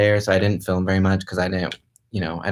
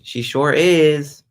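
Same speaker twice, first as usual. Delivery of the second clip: playful drawl; 5 semitones higher, 4 dB louder, much slower than usual. Real, not acted. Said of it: "playful confirmation".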